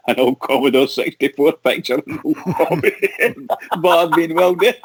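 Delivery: words breaking up into laughter; can't stop laughing. Real, not acted. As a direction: struggle to get the sentence out while laughing. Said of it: "So I can't stop laughing".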